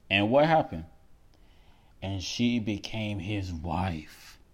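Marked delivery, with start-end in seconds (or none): 2.0-4.4 slow, emphatic reading, weight on she; read slower. Shown as a demonstration. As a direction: let the line land slowly. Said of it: "Let me read this slower".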